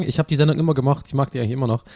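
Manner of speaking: ordinary talk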